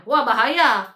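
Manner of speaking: ordinary talk, nothing marked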